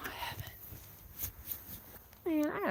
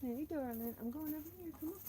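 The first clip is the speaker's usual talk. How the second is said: soft pet talk; 6 semitones higher, 16 dB quieter than usual. Real, not acted. Cooing to animals, high soft.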